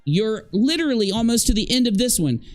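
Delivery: can't believe she only just figured it out; exasperated disbelief. Real, not acted. Mocking co-host's late realization.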